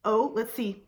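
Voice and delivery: ordinary talk, nothing marked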